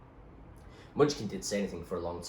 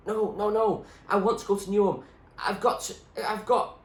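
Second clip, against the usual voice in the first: put-on higher, louder urgent girl; pleading girl; 13 semitones higher, 7 dB louder than usual. Zoe begging the doctor to remember, higher urgent voice. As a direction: insist desperately as Zoe.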